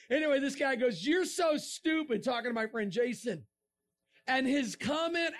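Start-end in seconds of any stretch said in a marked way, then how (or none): 1.1-2.2 high, slowed retold jeer; scornful commenter, imitated; mock insult. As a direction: draw out the imitated put-down. Repeats the quoted insult.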